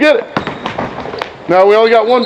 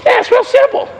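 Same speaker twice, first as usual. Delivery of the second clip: usual speaking voice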